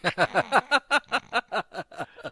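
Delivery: loud, high burst of laughter; real loud laugh. Real, not acted. Cohost hearing about the Cosby emblem, laughing hard. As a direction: crack up in a long run of laughs.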